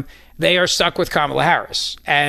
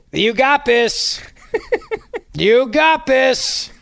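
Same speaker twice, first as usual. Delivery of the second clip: high, very drawn-out sarcastic encouragement; hammed-up, slow pep talk; mock cheerleading; 8 semitones higher, much slower than usual. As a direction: cheer on a hopeless candidate, stretching each word. Sarcastic cheering over tiny poll lead.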